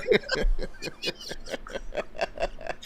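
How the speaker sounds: shrieking burst of laughter. Real, not acted. Host cracking up at his friend's joke, shrieking laugh. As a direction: let a high laugh burst out. Big laugh after bit.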